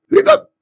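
high, loud hiccup squeak; dragon hiccup. A narrator's reading. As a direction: pop it out like a hiccup.

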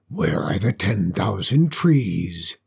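low, slow dragon demand; low, slow demanding beast. Acted. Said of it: "it demanded".

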